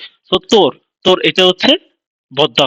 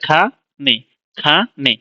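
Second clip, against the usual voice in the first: খা and নে each on its own, pause between; syllables apart; slower than usual. Shown as a demonstration. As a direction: split খানে into খা, নে. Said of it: "খানে বলার সময় দেখ".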